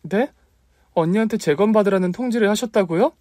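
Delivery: high, incredulous question; stunned shock. Acted. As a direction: gasp it out in disbelief. Sister stunned by news.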